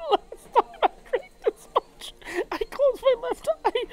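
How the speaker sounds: real tearful voice cracking high. Real, not acted. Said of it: "This time I'm crying".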